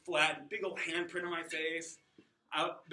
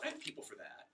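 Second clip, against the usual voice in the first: hushed, conspiratorial throwaway; whispered aside; 6 semitones lower, 9 dB quieter than usual. Pretend. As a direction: whisper the punchline like a dark secret.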